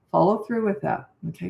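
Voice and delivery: ordinary talk, nothing marked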